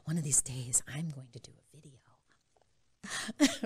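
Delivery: slow breathy whisper to herself; hushed inner voice; whispered thought. Retold or imitated. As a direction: whisper it like a private vow.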